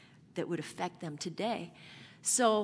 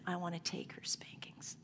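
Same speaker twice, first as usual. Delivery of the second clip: whispered, emotional offer; 11 dB quieter than usual. Retold or imitated. Father whispering to his wife that he'll take the punishment, whispered. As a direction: breathe it out like a confession.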